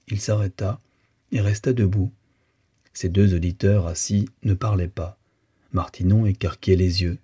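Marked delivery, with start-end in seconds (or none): none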